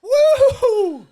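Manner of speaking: high, loud cheering; loud whoops. Real, not acted. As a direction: whoop it out at full volume. Cheering through the pain.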